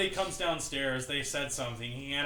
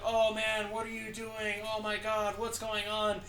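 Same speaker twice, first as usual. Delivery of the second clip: high, alarmed mock cabbie yelp; panicked cab driver; 8 semitones higher than usual. Cab driver seeing his passenger puke, high alarmed voice. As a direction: play the horrified driver watching you puke.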